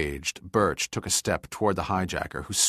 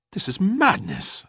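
much higher, louder indignant outburst; indignant snarl; 12 semitones higher, 6 dB louder than usual. A narrator's reading. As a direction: spit out the outrage. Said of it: "snarled Birch indignantly".

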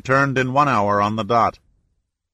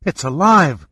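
a raised, louder gasp of relief; gasped joy; 4 semitones higher, 5 dB louder than usual. A narrator's reading. Shannon hearing Pitt survived, joyful gasp. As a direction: let it burst out in disbelief. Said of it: "gasped Shannon".

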